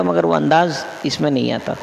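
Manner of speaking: usual speaking voice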